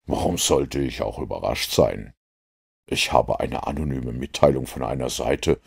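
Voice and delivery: low, soft, unruffled detective voice; composed Holmes. Acted. Holmes explains to the inspector why he isn't surprised, composed. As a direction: keep it low and coolly interested. Narrator plays Holmes, unsurprised.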